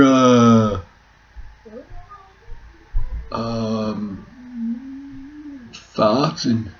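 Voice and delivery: high, slow put-on Yorkshire farmer; a hammed-up soap character; mock Yorkshire soap actor; Emmerdale audition voice. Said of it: "the Emmerdale audition".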